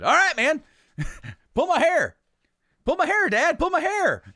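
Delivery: high, loud and eager, half laughing; eager kid. Retold or imitated. Boy urging dad in the store, high eager shout. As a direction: beg him to go ahead, thrilled.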